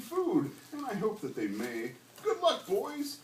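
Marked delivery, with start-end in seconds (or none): 2.2-3.2 cried out. A narrator's reading. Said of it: "he cried".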